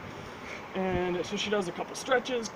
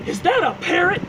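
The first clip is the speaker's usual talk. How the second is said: accusing pilot; 8 semitones higher, 6 dB louder than usual. Retold or imitated. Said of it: "And he goes".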